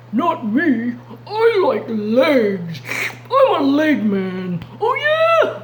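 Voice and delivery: second lion picking legs; hungry lion. Acted. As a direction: boast about legs like a lion. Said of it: "they might be saying something like this".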